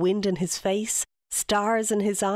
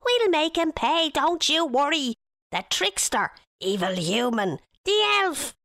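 angry fairy; 8 semitones higher than usual. Acted. Furious fairy threatening Guleesh.

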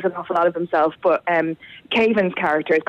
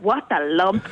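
Lumpy Space Princess voice; slower than usual. Retold or imitated. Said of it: "we'll go like".

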